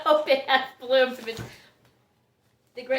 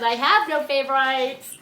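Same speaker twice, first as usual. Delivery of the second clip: mock-insistent mother, louder than usual; mother declaring impartiality; 3 semitones higher, 6 dB louder than usual. Grower likening orchids to her children, as a loudly insistent mother. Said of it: "like the mother that says".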